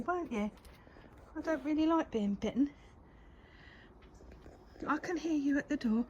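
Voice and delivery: high coaxing pet voice. Real, not acted. Owner cooing at the sulking cat through the door.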